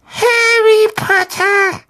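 very high, drawn-out elf squeak; piercing squeak. A narrator's reading. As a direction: squeak the name out slowly, piercingly. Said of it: "mit so durchdringender Piepsstimme". (durchdringende Piepsstimme)

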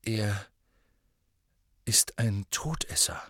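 slowly, with a long pause after the first word; halting, hushed statement; slow realisation. A narrator's reading. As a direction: let it dawn on you word by word. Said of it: "sagte Harry langsam".

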